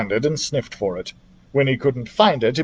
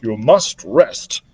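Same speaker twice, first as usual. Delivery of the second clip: gravely; 4 semitones lower, much slower than usual. Acted. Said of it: "said Doctor Drake gravely".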